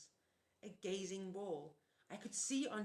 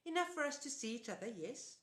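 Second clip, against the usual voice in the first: put-on high, sing-song old auntie; sweet old lady; 6 semitones higher, 3 dB louder than usual. A narrator's reading. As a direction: chirp it like a doting aunt. Auntie Em speaking.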